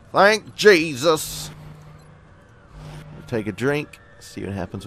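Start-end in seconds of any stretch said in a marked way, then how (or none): 0.1-1.5 loud, high, drawn-out thanks; big relieved cry; exclaimed relief. Real, not acted. Relief as no enemy appears.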